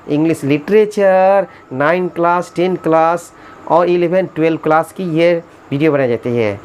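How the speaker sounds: normal talking voice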